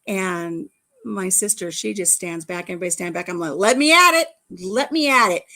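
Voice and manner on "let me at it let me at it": loud, high, eager "let me at it"; eager shout. Retold or imitated. Me at the apple bobbing contest, shouting eagerly. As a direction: push forward, raring to go.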